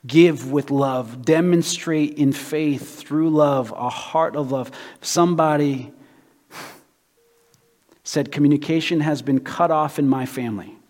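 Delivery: big pulpit voice, pressing every line; preaching at full force. Acted. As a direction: preach it out to the whole room. Preaching with a quoted complaint.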